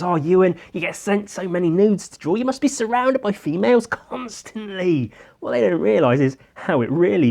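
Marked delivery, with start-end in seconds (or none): 0.0-5.1 higher, teasing imitation of others; lightly voiced gushing fan; mocking imitation. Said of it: "This is how they think it be".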